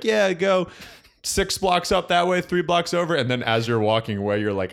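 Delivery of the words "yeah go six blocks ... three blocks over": giving directions to tourists. Retold or imitated. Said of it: "And you're like".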